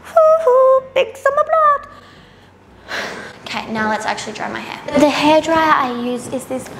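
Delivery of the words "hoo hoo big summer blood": much higher, slow, put-on silly voice; TikTok sound imitation. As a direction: mimic the viral TikTok audio. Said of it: "that TikTok thing is going around".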